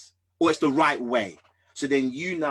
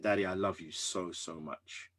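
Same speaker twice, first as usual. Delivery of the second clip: retold tearful words; 4 semitones lower, 8 dB quieter than usual. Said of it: "I just broke down crying".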